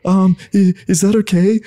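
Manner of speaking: high-pitched, hesitant mock question; timid asking. Shown as a demonstration. Man checking in with his partner, timidly. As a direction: ask as if unsure of yourself. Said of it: "So instead of being like".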